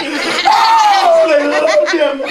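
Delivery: squealing high laugh on oh; swooning laugh. Acted. Girls swooning and laughing over the rich young man.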